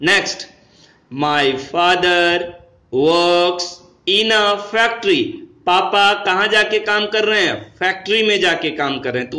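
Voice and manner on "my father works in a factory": slow dictation. Shown as a demonstration. Teacher reads example sentence.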